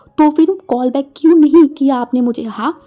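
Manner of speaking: loud furious berating, pitch rising toward the end; threatening. Acted. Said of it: "धमका के बोला". (धमकाते हुए ज़ोर से चिल्लाना)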